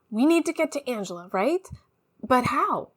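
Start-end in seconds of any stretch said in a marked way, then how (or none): none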